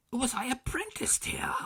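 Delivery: startled recognition; high amazed exclaim; amazed Scrooge. A narrator's reading.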